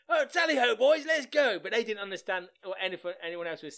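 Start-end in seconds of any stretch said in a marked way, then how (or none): 0.1-1.6 much higher and louder mock RAF toff; posh English send-up. Mocking the sitcom's English airmen, posh English send-up. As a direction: bray it like a jolly English airman. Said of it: "they were all like".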